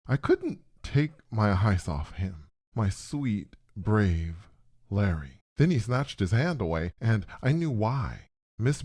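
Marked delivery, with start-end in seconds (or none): none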